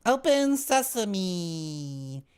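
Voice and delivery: very high, very slow spell; alien voice. Acted. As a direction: stretch it out like an alien chant. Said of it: "repeats the alien command".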